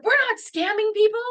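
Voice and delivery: high-pitched indignant protest, retold; mock outraged shouting, pitch shooting up; imitated angry shout. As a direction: mimic a manager shrieking a denial. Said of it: "she kept shouting at me".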